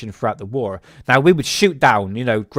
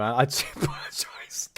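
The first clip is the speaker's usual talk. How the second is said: whisper; 9 dB quieter than usual. Real, not acted. After a burp, embarrassed.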